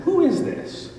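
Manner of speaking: high, squeaky startled question; startled cry. Retold or imitated. Man dangling from cliff startled by the voice, squeaking high. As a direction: yelp it out in disbelief.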